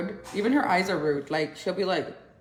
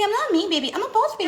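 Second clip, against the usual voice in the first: put-on much higher baby voice; voicing the baby; 13 semitones higher, faster than usual. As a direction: speak for the baby proudly.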